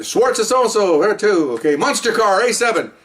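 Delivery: high-pitched roll-call announcement; sergeant calling roll. Retold or imitated. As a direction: sing out the assignments, pitched well up.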